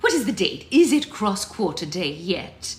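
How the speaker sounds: loud, urgent demand. Acted. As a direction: press hard for an answer. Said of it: "urgently added".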